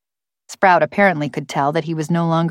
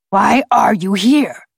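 slow, higher gravelly bird demand; forced, gravelly voice; 4 semitones higher, 4 dB louder, slower than usual. A narrator's reading. Bird questioning Sprout on why he came, slow gravelly voice. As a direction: question them slowly as a talking bird. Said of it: "gravelly voice".

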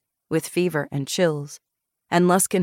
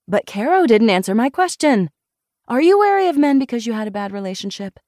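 higher, louder, insistent friend; Molly, pressing questioner; 6 semitones higher, 4 dB louder than usual. Acted. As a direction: press her for an answer.

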